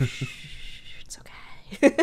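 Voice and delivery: calm him down in a whisper; hushing whisper. Retold or imitated. Mabel hushing worried Soos.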